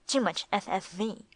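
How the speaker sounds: ordinary delivery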